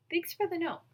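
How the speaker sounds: bright thanks. Acted. Justin thanks Brian brightly.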